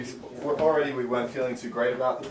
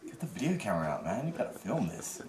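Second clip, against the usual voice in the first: fast, softer aside to a friend; hushed urging; 9 dB quieter than usual. Retold or imitated. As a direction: urge him quietly to film. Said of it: "and I'm like".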